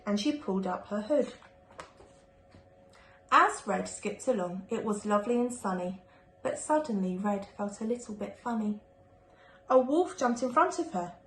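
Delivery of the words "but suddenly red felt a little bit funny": plain reading voice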